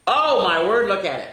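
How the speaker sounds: plain reading voice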